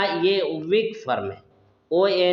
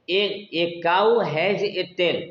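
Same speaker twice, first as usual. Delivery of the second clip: slow demo; much slower than usual. Shown as a demonstration. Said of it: "जैसे कहना हो कि".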